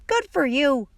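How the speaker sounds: put-on high old-lady call; grandma yelling. A narrator's reading. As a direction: cheer a child on as a grandma. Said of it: "she yelled".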